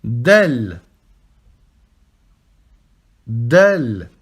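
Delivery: Del low and firm; Arabic letter name. Shown as a demonstration. Teacher modelling Del for students, firmly. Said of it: "lire chaque lettre".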